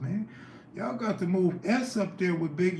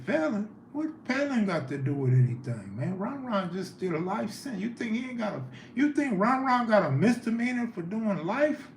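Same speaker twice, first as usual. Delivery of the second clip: incredulous retort; 6 semitones higher than usual. Retold or imitated. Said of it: "I said".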